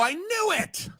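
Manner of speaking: high, rising gleeful shout; triumphant cry. Acted. Boomer shouting at his neighbor in gleeful vindication. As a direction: crow it like you were right all along.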